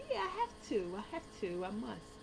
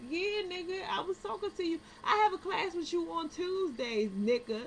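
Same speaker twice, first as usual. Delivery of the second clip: higher, louder, incredulous comeback; exasperated retort; 9 semitones higher, 4 dB louder than usual. Retold or imitated. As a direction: done with their nonsense. Exasperated reply to classmate.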